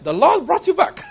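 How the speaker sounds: high, laughing mock of the beggar's blessing; beggar's blessing broken up by laughter; laughing imitation of the beggar. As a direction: squeal it through laughter, mocking his line. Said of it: "the guy said".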